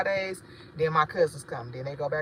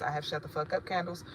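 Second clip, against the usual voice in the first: normal talking voice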